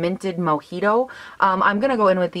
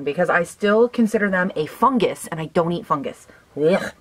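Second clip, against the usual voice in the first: ordinary talk, nothing marked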